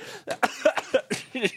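real coughing fit on own spit; sudden splutter breaking off the read; choking cough. Real, not acted. Host choking while reading out the phone number, spluttering cough. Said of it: "I choke on my own spit".

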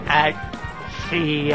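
ordinary talk, nothing marked

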